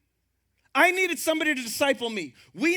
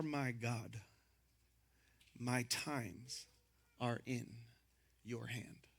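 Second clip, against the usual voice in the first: much softer and lower, slow; hushed scripture reading; 11 semitones lower, 12 dB quieter than usual. Real, not acted. Pastor reading David's psalm quietly to the congregation. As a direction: read the psalm like a quiet prayer.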